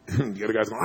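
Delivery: ordinary talk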